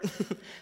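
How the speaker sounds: brief breathy chuckle; real chuckle between phrases. Real, not acted. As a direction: let out a small laugh before the reveal. Laughs before the big number.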